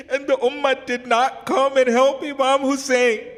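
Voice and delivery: high, loud tearful lament; anguished lament. Real, not acted. Preacher mourning the ummah's failure to help Hussein, anguished lament. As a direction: cry it out in grief.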